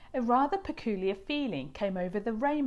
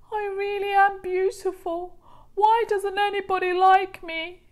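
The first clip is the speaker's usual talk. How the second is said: higher, louder hurt complaint; plaintive rainbow fish; 12 semitones higher, 9 dB louder than usual. A narrator's reading. Rainbow fish pours out troubles to starfish, hurt. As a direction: whine about being unloved.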